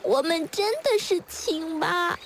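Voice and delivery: high, plaintive little tadpole; small tearful voice. Acted. Tadpoles explaining to old turtle, small and tearful. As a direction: plead timidly through tears. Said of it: "很小声地说".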